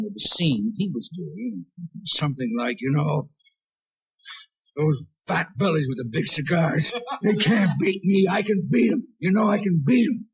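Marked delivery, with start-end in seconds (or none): 4.8-10.3 Kirk Douglas impression. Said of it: "Rich Little was doing an impression of me".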